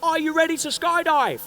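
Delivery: high, loud shout over the engines; instructor shouting. Retold or imitated. Instructor yells to the first-timer over the engines, loud. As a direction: bellow the question over the plane noise.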